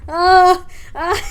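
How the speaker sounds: sudden loud frightened cry; startled scream. Real, not acted. Player jolted by the game, screaming. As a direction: let out a real scream of fright. Jump scare in horror game.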